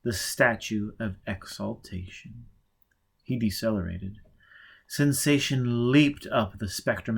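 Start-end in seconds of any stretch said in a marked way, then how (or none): none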